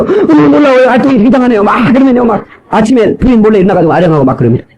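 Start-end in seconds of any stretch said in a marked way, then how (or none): 0.0-1.6 high, gushing mock wonder; amazed. Shown as a demonstration. Preacher showing wives how to amaze husbands, gushing surprise. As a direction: demonstrate delighted astonishment. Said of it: "이렇게 한번 해보세요".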